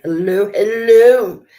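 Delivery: loud, high, drawn-out greeting; calling out hello. Real, not acted. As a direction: hail a viewer at full volume.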